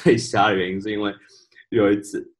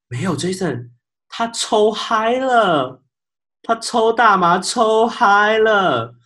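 higher and louder, dragging every word out very slowly; solemn, righteous warning; 12 semitones higher, 5 dB louder, much slower than usual. Retold or imitated. Coworker gravely warning Jason about the guest, very slow and high. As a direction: spell it out slowly and gravely, as if this is a disaster. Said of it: "义正言辞跟我说".

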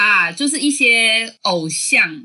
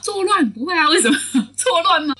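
normal talking voice

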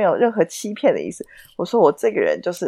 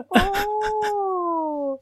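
高而轻地拉长哦; 愣住后轻轻应一声; 尴尬的一声哦; 13 semitones higher, 6 dB quieter than usual. Retold or imitated. 尴尬地回应老板. (被老板质疑说谎后尴尬地高声轻哦)

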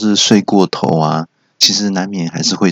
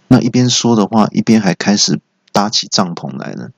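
ordinary delivery

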